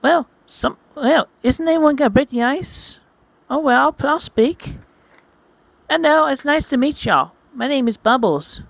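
high, hesitant hedgehog voice; shy high voice. Acted. Bubbles introducing herself to the group, high and shy. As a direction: nervously break the ice. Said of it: "Bubbles is thinking".

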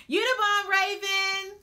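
loud, high cheer; excited shout. Real, not acted. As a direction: shout it out, hyped. Cheering on a participant.

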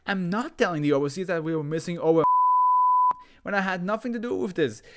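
high frantic shriek. Acted. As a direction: shriek in panic. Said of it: "His voice rising to a shriek".

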